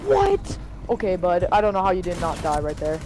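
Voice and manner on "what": high, sharp startled what; shocked what. Real, not acted. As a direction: blurt it out in shock. Shocked reaction in game.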